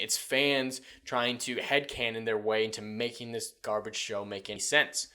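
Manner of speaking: ordinary talk, nothing marked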